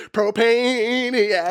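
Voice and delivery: very slow, high, drawn-out band-name call; hyped announcer. Retold or imitated. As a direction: stretch the name like a stadium hype man.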